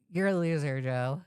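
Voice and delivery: usual speaking voice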